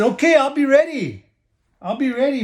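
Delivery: high, cheerful little pig. Acted. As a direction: agree brightly.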